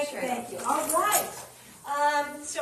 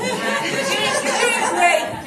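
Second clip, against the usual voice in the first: loud, cheering words of support; loud encouragement; 10 semitones higher, 9 dB louder than usual. Real, not acted. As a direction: cheer her on loudly. Cheering on a nervous speaker.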